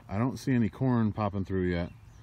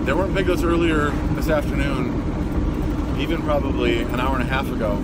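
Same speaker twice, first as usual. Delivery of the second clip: loud, eager news of new piglets; excited; 4 semitones higher, 10 dB louder than usual. Real, not acted. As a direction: burst with excitement, talk loud. Rushing home excited, piglets born.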